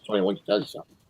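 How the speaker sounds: low, confiding driver. Retold or imitated.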